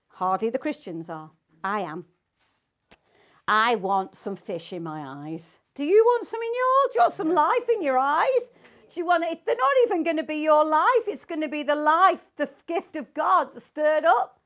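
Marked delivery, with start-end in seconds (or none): 5.7-14.4 high, loud appeal to the congregation; rousing preaching. Real, not acted. Preacher to congregation about life in their eyes, rousing preaching. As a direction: call out and stir them up, pitch raised.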